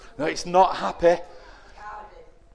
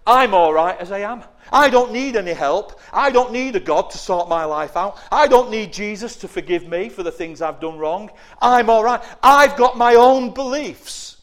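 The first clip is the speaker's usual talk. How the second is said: self-satisfied voice; 4 semitones higher, 6 dB louder than usual. Acted. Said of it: "people say".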